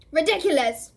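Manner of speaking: loud bellow. A narrator's reading. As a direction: bellow the spell at the spider. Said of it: "bellowed Ron".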